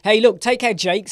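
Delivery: high, loud call out; loud sendoff. Real, not acted. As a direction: call it out warmly. Calling out to a viewer.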